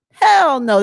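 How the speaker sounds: emphatic denial. Real, not acted. Emphatic rebuttal.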